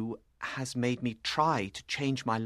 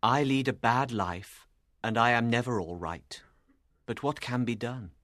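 low, downcast character voice; sad, resigned man; 7 semitones lower than usual. A narrator's reading. Dissolute man owns up to Lucy, sadly. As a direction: confess it sadly in a deep voice. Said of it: "he replied sadly".

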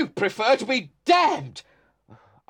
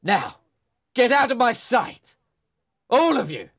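put-on louder drunken order; angry dismissal; 2 semitones higher, 5 dB louder than usual. A narrator's reading. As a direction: drive them all out of the room. Drunk Hindley orders everyone out.

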